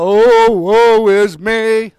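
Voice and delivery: slow, drawn-out mock lament; mock self-pity. Preacher mocks complaining Christians with slow whining. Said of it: "crying out".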